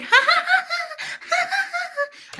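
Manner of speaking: very high mock giggle; squealed, breathy ha-ha-ha mimicking a laughing girl; imitated laughter. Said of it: "she was like".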